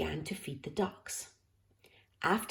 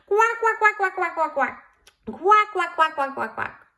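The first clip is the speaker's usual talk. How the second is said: high, loud happy duck quacks; loud quacking; 10 semitones higher, 10 dB louder than usual. A narrator's reading. The duck circling happily, high, loud quacks. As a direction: quack loudly, swimming in circles. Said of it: "quacking loudly".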